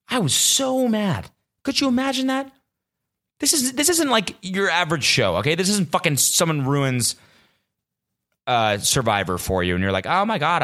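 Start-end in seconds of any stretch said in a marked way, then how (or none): 0.1-7.1 high-pitched indignant rant; exasperated anger. Real, not acted. 10.1-10.6 higher mock-whiny complaint; mock dismay. Mocks a viewer of another show.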